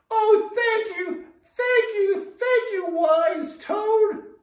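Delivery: high, loud, joyful thanks; grateful frog chorus. Acted. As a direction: cheer it out like many tiny frogs. Frogs thank the toad together.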